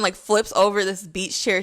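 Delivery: ordinary delivery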